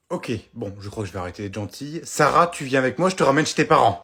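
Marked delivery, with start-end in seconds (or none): 2.0-4.0 loud, firm motherly order; stern scolding. Acted. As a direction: lay down the law, loudly. Mother loses patience with girl.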